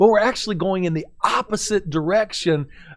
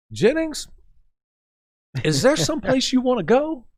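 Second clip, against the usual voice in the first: sarcastic coach; 7 semitones higher than usual. Retold or imitated. Driving coach asking the student where he is going, sarcastic. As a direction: needle the student with mock patience.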